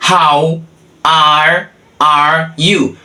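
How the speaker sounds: slow, word by word with gaps; careful pronunciation demo. Shown as a demonstration. Teacher shows how he pronounces the question, careful pronunciation demo. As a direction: isolate each word for the learner. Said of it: "olha como é que eu pronuncio".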